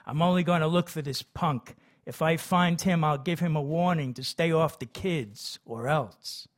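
ordinary delivery, nothing marked

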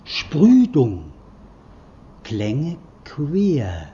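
slow, loud, drawn-out sound poem; sounding out words. Shown as a demonstration. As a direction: declaim the syllables slowly, with sudden cries. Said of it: "Zuerst wieder eine Überschreibung". (langsam und laut deklamierte Lautpoesie)

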